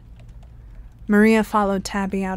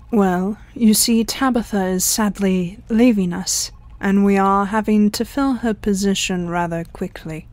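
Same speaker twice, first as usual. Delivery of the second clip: ordinary delivery, nothing marked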